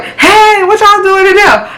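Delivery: very high-pitched imitation of a grandma's holler; grandma calling out. Boyfriend's grandma calling to the arguing couple, high holler. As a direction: call it through the house, high and drawn-out. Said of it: "I could hear his grandma like".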